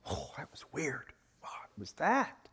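hushed, puzzled waking voice; dazed half-whisper. Acted. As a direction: murmur it, just out of a trance. Peter waking from trance.

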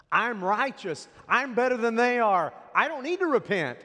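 hammed-up self-congratulation; self-righteous boasting. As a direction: imitate smug religious folks proclaiming their virtue. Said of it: "how they viewed themselves".

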